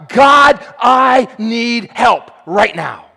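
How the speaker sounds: loud desperate cry to God, slowed on the plea. Acted. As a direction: shout the plea, dragging it out. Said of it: "We learn to cry out to God".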